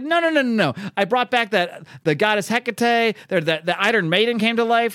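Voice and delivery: high-pitched mock denial; flustered acted insistence; indignant protest. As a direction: protest rapid-fire, jumping up in pitch. Rasputin protests he is important.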